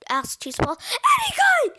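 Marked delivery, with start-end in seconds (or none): 1.1-1.7 very high, loud yell; frantic, high-pitched shout; exasperated scream. A narrator's reading. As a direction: scream the answer in urgency. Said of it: "screamed Fluffy".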